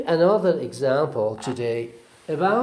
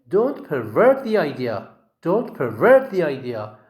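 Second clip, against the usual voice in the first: "pervert" stressed on VERT; second-syllable stress; 3 semitones higher, 4 dB louder than usual. Shown as a demonstration. Teacher models the verb in a sentence, stressing VERT in "pervert". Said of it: "the stress on the second syllable".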